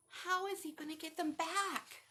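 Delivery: plain reading voice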